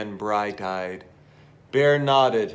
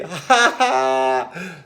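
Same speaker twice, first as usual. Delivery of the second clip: loud mock laugh of the mouse; hearty laugh; 3 semitones higher, 5 dB louder than usual. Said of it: "The mouse laughed heartily".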